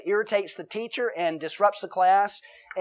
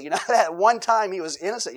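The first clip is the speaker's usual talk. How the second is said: ordinary talk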